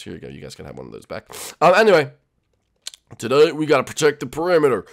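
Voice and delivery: ordinary talk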